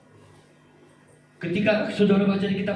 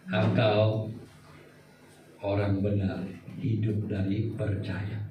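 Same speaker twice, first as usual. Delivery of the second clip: lower, softer and slow; calm God voice; 10 semitones lower, 5 dB quieter, slower than usual. Acted. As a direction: answer gently and gravely as God. God answers Habakkuk. (Tuhan menjawab Habakuk dengan lembut, rendah dan pelan)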